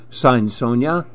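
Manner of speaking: ordinary delivery, nothing marked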